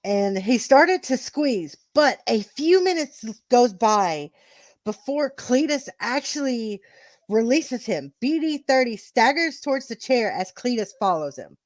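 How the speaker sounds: emphatic match storytelling; wrestling commentary. Acted. Narrator telling the title match to co-hosts, commentary style. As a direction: punch the key words of the action.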